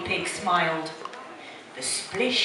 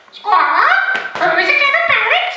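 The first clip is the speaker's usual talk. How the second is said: high, loud, quick squawking parrot; shrieky mimicking bird; 8 dB louder than usual. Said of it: "It's my parrot voice".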